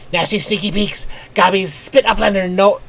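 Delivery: gleeful goblin cackle-talk; raspy little gobbie sneer. Acted. Voices goblin NPC line.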